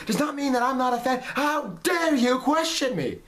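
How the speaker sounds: high, indignant whiny fan voice, a mock rant; mock outraged fan. Parodying hateful fans who say they are fans, in a high indignant whine. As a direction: sneer it out as a hammed-up entitled fan. Mocking toxic fans' outraged voice.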